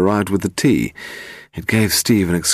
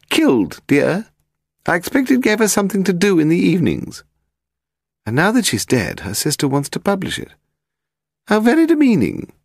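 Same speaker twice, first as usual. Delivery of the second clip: put-on high, haughty elderly lady; very old woman; 14 semitones higher, 3 dB louder than usual. A narrator's reading. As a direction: play a grand, frail old aristocrat. Lady Delamore talking.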